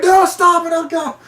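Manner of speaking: high, loud horrified cry; panicked shriek. Retold or imitated. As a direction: shriek in horror. Being vomited on.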